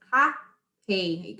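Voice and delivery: "happy" split with a gap, hap, py; syllable by syllable. Shown as a demonstration. Teacher showing how the mouth moves, "happy" in two beats. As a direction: open the mouth separately for each half of "happy". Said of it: "in my mouth it goes".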